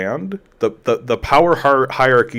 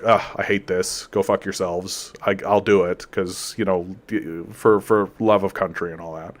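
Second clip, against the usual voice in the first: reluctant grumble; 4 semitones lower, 6 dB quieter than usual. Retold or imitated. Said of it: "the guy's like".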